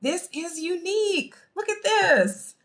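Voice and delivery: high, slow, drawn-out false delight; fake appreciation. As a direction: stretch it out as if admiring a gift you dislike. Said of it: "how you use your vocal intonation".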